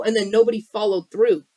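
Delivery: ordinary talk, nothing marked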